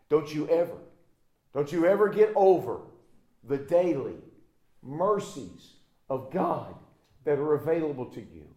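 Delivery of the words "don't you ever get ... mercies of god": normal talking voice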